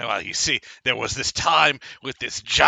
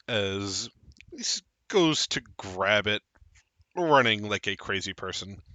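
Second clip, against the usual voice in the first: usual speaking voice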